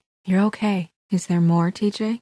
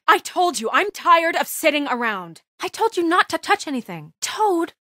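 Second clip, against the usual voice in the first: angry scolding; 9 semitones higher than usual. Acted. Angry Toad scolding the child.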